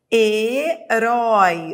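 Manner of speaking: Herói stretched slowly, vowel glide drawn out; teacher sounding out Herói slowly for students; slow demo pronunciation. Shown as a demonstration. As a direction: spell out Herói by ear, slowly. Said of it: "Eu vou da vogal".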